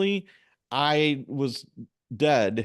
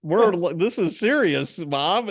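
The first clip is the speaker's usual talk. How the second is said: much higher, louder, urgent pleading; urgent plea; 7 semitones higher, 4 dB louder, slower than usual. Retold or imitated. Kid begging his mom to call for help, high urgent plea. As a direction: beg her to see how serious it is.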